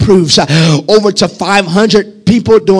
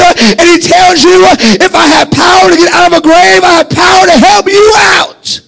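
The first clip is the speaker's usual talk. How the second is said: preaching at full force; 6 semitones higher than usual. Real, not acted. Preacher promising viewers a great future, full-force preaching. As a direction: drive the promise home with rising force. Closing crescendo.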